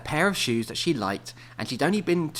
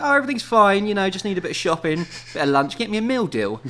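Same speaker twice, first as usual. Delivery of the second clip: breezy fake calm; 8 semitones higher, 6 dB louder than usual. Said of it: "He's just like".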